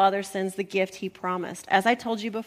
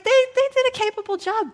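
much higher, put-on objector's voice; high-pitched protest; 11 semitones higher, 4 dB louder than usual. Listener defending the disciples' record, high-pitched protest.

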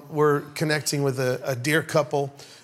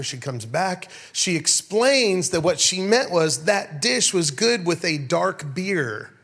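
ordinary talk

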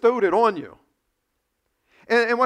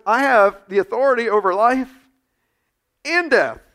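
louder, rising claim over life and death; triumphant declaration; 3 semitones higher, 8 dB louder than usual. Retold or imitated. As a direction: declare it with full force. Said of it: "He says".

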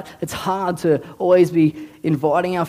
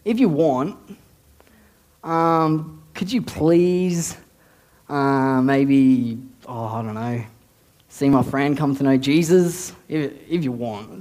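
halting, timid plea sagging lower as it goes; hesitant mock prayer; 3 semitones lower, slower than usual. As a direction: pray as if unsure you may ask, with long gaps. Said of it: "I'm not praying".

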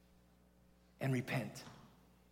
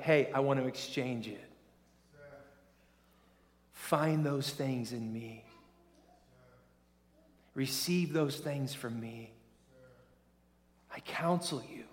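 gentle voice of God; 4 dB quieter than usual. Retold or imitated. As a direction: coax them softly to come to him. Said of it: "he's like".

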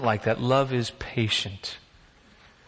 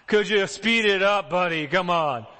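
mock impatient parent, raised and pushy; 5 semitones higher, slower than usual. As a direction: hurry a slow child, fed up. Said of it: "when they're taking forever".

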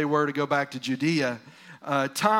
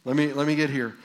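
ordinary talk, nothing marked

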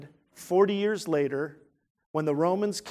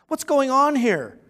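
stirred city asking; 8 semitones higher, 6 dB louder, slower than usual. Retold or imitated. City stirred, crowd asks.

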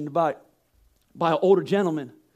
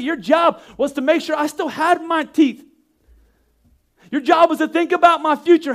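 put-on grown child's complaint; 5 semitones higher than usual. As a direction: plead like a kid blaming his dad. Grown child confronting parent.

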